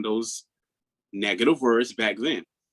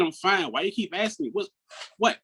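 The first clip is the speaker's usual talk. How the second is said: irritated snapping; 8 semitones higher than usual. Retold or imitated. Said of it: "I became really aggressive".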